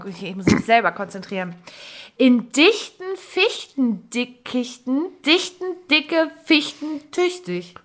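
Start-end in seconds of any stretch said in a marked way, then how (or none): 2.2-7.8 slow and careful tongue twister. Shown as a demonstration. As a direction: pronounce every syllable slowly. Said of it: "Sage dreimal hintereinander".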